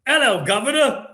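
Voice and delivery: loud, high, hammed-up Australian accent that lands British; bad Australian accent. Shown as a demonstration. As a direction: botch an Australian accent as a booming Cockney-style greeting. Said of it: "I have an Australian accent".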